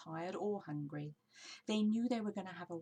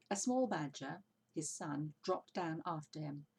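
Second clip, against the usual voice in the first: plain reading voice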